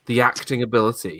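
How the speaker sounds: ordinary talk, nothing marked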